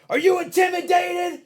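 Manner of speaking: high, loud yelled challenge; screaming madman impression. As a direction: scream it like an unhinged motivational speaker. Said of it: "he was screaming and yelling".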